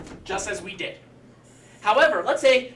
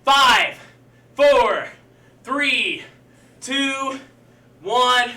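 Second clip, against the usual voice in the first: loud countdown; 4 semitones higher, 8 dB louder, much slower than usual. Real, not acted. Coach counting down the clock.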